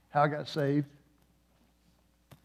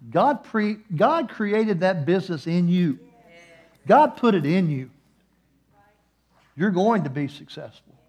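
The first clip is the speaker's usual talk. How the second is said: loud, higher preaching climb; full-force preaching; 4 semitones higher, 8 dB louder than usual. Acted. Preacher telling the congregation of crying on stage, loud and rising. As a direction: preach it out to the crowd.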